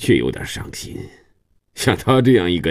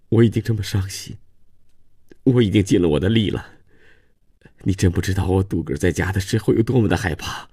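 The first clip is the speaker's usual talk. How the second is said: fast, higher tearful plea; tearful pleading; 5 semitones higher than usual. A narrator's reading. Daughter-in-law explains her fear to grandfather, tearful and quick. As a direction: rush the words out on the edge of tears. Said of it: "路易莎哭得更厉害了".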